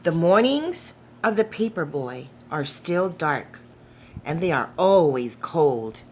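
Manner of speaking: ordinary delivery, nothing marked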